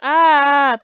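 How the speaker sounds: loud, long cry of alarm; startled yell. Real, not acted. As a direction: yell out in surprise. Startled by a Pidgey.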